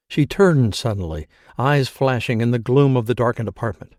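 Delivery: usual speaking voice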